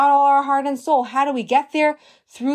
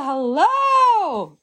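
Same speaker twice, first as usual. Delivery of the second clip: drawn-out, higher sweet hello; big warm smile; 6 semitones higher than usual. Acted. Husband greets his astonished wife, big warm smile. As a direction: greet with an exaggerated beaming smile. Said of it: "greets his wife with this big, warm smile".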